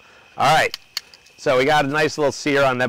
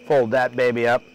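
ordinary delivery

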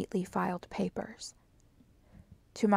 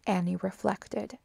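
plain reading voice

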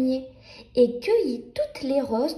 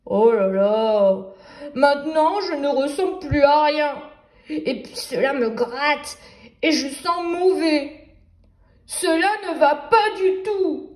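a fed-up wolf groaning at the mud; wolf sighing; 4 dB louder than usual. Acted. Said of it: "et soupira".

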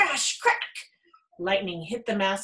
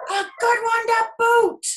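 much higher, louder, slow crew voice; pirate crew; 8 dB louder than usual. A narrator's reading. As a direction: echo it like a rowdy chorus. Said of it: "the others agreed".

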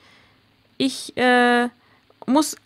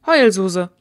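low, louder defiant jab; defiant taunt; 5 semitones lower, 5 dB louder than usual. Acted. Sophie repeats the insult to Reagan's face, defiant. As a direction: throw the insult right back at her. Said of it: "sag das nochmal".

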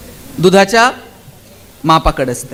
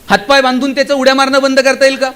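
usual speaking voice

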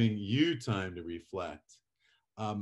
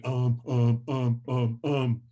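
floundering speaker; 2 semitones higher, 4 dB louder, slower than usual. Retold or imitated. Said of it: "instead of saying".

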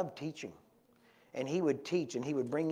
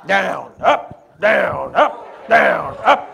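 loud see-saw chant of orders; shouted preacher commands; 4 semitones higher, 17 dB louder, much slower than usual. Retold or imitated. Preacher pumping the woman's head, shouted commands. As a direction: bellow each word in turn, jumping in pitch.

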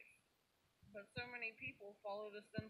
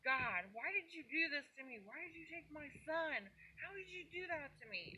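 raised, higher, rushing cry of grief; anguished scream at God; 7 semitones higher than usual. Retold or imitated. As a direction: hurl the question at God in pain. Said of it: "and I screamed".

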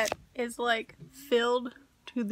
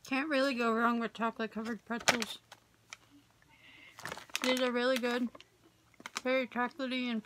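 chewing while talking; speaking around food; mouth full; much faster than usual. Real, not acted. Seen 1: hand to mouth at -1, jaw and lips working while speaking.

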